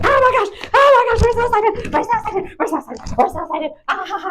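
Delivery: very high, squealing mock excitement; imitating overjoyed dog. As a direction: play a dog too excited to stop running circles. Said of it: "so happy".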